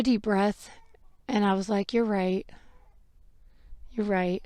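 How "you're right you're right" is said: retold calm acceptance. Calmed after taking a deep breath.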